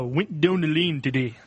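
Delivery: Geordie demo line; imitated Geordie, "down" nearly "doon"; Geordie accent. Shown as a demonstration. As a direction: say it in Geordie with rounded vowels. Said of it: "They also had a line of".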